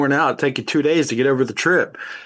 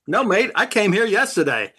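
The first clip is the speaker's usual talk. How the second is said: higher-pitched Aussie punchline; Australian accent; 7 semitones higher than usual. Acted. Retelling the soldier's answer for laughs, high Australian accent. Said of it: "you got that accent down really good".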